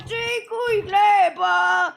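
slow loud drawn-out shout. Pretend. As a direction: stretch it out, shouting. Drawn out calling out.

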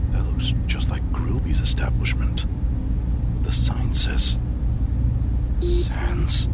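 hushed whispered inner monologue. Acted. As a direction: breathe it out like a secret thought. Whispered private thoughts while investigating.

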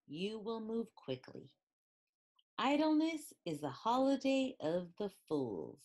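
ordinary delivery

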